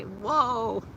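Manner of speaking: lightly imitated cartoon cowboy whoa; a mild take on the cartoon cowboy's whoa; Yosemite Sam. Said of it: "You know what I say?".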